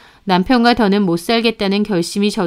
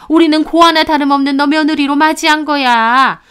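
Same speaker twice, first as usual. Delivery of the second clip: put-on higher, sharp-tongued older woman scolding; scolding mother-in-law; 7 semitones higher, 5 dB louder than usual. Mother-in-law lecturing her daughter-in-law at the funeral, higher and scornful. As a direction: berate her like a contemptuous mother-in-law, pitched up.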